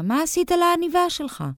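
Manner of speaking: very high, incredulous question; astonished question. A narrator's reading. Daughter amazed at the drawing on the tie, astonished question. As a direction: ask in amazement, pitch soaring. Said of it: "קראה בפליאה".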